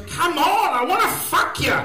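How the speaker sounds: loud, high come-on; eager woman's voice. Retold or imitated. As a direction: call out like a keen woman. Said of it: "she goes".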